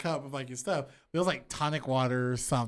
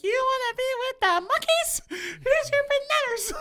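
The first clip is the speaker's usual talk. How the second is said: very high squeaky Tommy Pickles voice; cartoon baby voice. Voicing Tommy from Rugrats.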